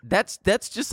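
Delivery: normal talking voice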